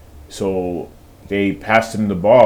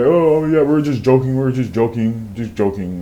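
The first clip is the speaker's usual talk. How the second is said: starts high, then settles down; mock sheepish excuse; 3 semitones higher than usual. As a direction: imitate someone backpedalling, high at first. Imitates chastened traders' hurried excuse.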